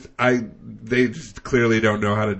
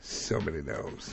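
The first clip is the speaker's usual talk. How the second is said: hushed; 6 semitones lower, 16 dB quieter than usual. Acted. Awed low aside.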